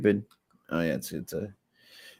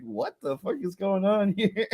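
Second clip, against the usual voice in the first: retold bewilderment; 7 semitones higher, 5 dB quieter than usual. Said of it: "He's like".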